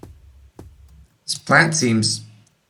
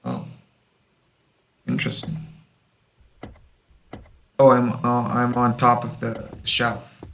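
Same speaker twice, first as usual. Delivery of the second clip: usual speaking voice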